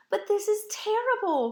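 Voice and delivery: put-on high-pitched young girl's cry of dismay; distressed cry. A narrator's reading. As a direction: protest in upset disbelief. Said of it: "cried Mirette".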